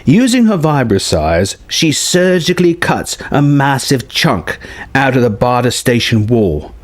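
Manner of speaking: usual speaking voice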